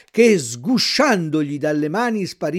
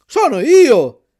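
slow, loud, higher call up to the window; 7 semitones higher, 11 dB louder, much slower than usual. A narrator's reading. Pinocchio calls up at night. (Pinocchio chiama la lumaca dalla strada, forte e lento)

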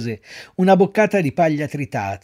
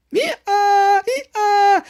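very high, loud braying; donkey bray; 6 dB louder than usual. A narrator's reading. Pinocchio braying again to his master, loud bray.

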